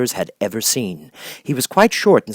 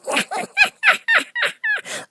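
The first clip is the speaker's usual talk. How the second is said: high harsh mocking cackle; harsh laugh. Hammerhead mocking the tumbling Scarecrow, harsh laugh. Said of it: "the man laughed harshly".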